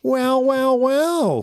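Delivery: very high, loud gloating witch. A narrator's reading. Lead witch catching the heroes, high gloating. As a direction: savor each well like a wicked witch. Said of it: "said the lead witch".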